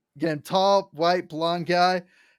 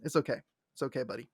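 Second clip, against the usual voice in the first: lower, much softer comfort; soft reassurance; 5 semitones lower, 8 dB quieter, much faster than usual. Retold or imitated. Scanlan forgiving Grog, soft reassurance. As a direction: comfort him gently. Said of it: "Scan's like".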